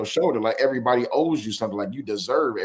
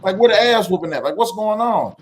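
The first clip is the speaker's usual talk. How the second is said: shocked reaction; 8 semitones higher, 3 dB louder than usual. Retold or imitated. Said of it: "I'm just like".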